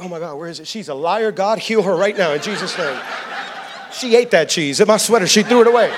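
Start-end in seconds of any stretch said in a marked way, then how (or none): 0.0-0.7 much softer, lower, muttered under the breath; a husband grumbling to himself in the pantry; exasperated husband mumble. Retold or imitated. Husband in the pantry mutters to himself, muttered. Husband can't find the sweater.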